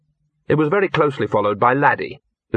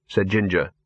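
ordinary delivery

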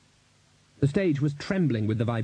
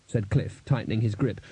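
ordinary delivery, nothing marked

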